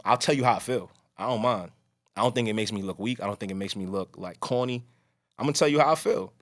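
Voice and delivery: usual speaking voice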